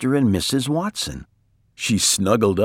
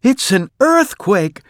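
high-pitched cry of alarm; alarmed shout; 12 semitones higher, 6 dB louder than usual. A narrator's reading. Missus Watson woken as the bed falls, alarmed shout. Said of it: "shouted Missus Watson".

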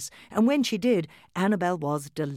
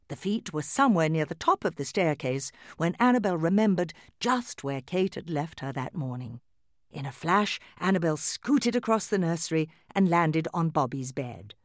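ordinary delivery, nothing marked

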